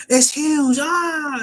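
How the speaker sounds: high, loud mock shriek at a huge bear; terrified shriek. Nami spots a giant bear and shrieks in terror. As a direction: squeal it like she just saw a monster. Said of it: "She's like".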